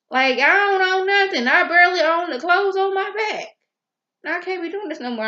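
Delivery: high, loud, exasperated wail; mock frustrated rant, pitched well up; fed-up outburst. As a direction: vent like you've had enough. Voicing Hannah's fed-up outburst.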